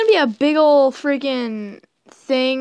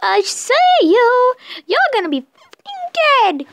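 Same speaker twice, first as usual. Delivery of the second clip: put-on high menacing old woman; creepy granny voice; 10 semitones higher, 3 dB louder than usual.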